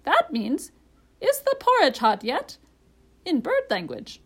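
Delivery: high, loud bird voice; parrot, explaining. A narrator's reading. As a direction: translate with emphatic swoops.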